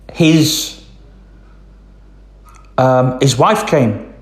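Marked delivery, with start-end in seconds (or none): none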